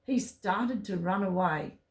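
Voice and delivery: usual speaking voice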